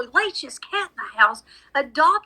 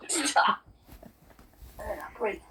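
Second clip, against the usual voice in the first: breathy whisper, fighting nausea; whispered stop; 2 semitones lower, 7 dB quieter than usual. Real, not acted. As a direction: whisper it through disgust. Disgusted, fighting the gag.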